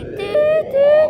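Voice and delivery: dude called out high and eager; high urgent calling. Pretend. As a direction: squeak it out, hurry them. Urging a friend in game.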